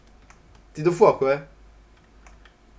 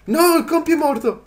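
high, louder wail of loss; dismayed cry; 12 semitones higher, 5 dB louder than usual. Real, not acted. Gamer seeing his companion Compi die, high dismayed cry. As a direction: cry out that your companion is gone.

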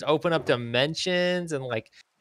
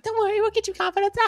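very high, fast hero voice; squeaky, rushed pep talk; cartoon Ash; faster than usual. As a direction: chirp it like an eager anime boy. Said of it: "And Ash is like".

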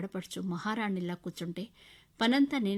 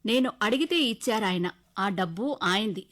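louder, cutting reply; sharp retort; 2 semitones higher, 8 dB louder than usual. Acted. Wife defending her father's money, loud sharp retort. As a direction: stand up to him sharply. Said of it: "అన్నాను విసురుగా".

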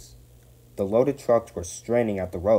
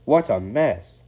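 raised, louder cry of dismay; exclaiming; 4 semitones higher, 7 dB louder, slower than usual. A narrator's reading. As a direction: exclaim at the sight of a wreck. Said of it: "exclaimed Rusty".

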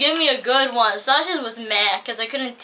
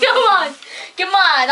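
laughing urging; 7 semitones higher, 6 dB louder than usual. Real, not acted.

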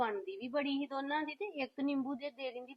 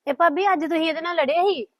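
much louder, arguing back hard; raised voice in a family row; loud scolding; 4 semitones higher, 13 dB louder than usual. Acted. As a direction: shout the old quarrel at her. Heated family argument.

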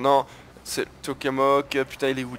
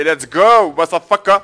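hype shout; 4 semitones higher, 10 dB louder, slower than usual. Real, not acted. Hype after launching units.

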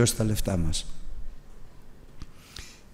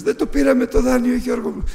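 higher, louder, tearful wail; mock-tearful lament from the wife; imitating a crying wife; 13 semitones higher, 4 dB louder than usual. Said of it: "κλαίγοντας".

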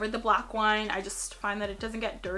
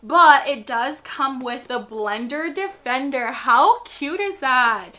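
excited; 8 dB louder, slower than usual. Real, not acted. Gushing over cute set.

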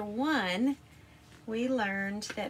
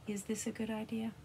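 usual speaking voice

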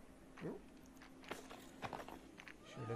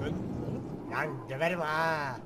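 mock threat; 5 semitones lower, 6 dB louder than usual. Threatening the game character.

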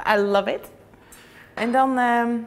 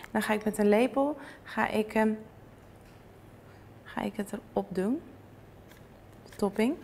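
ordinary talk

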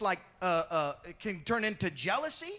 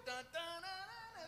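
hummed fanfare; 8 semitones higher, 16 dB quieter, much faster than usual. Acted. Fanfare for Peter's feat.

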